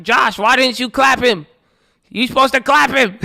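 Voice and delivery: high, loud mock-angry brother voice; mad brother. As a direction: play the jealous brother whining high and loud. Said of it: "He's like".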